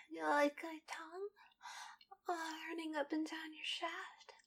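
soft sensual whisper. Acted. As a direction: breathe the words intimately.

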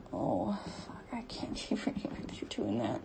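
low, overwhelmed whisper; breathless whisper. Real, not acted. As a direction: whisper it, barely coping. Overwhelmed whisper while being touched.

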